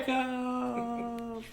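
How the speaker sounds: high held note, fading softly; soft, distant, drawn-out wail; whizzing past. Shown as a demonstration. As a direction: let it trail off into the distance. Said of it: "Maybe she's going by really fast".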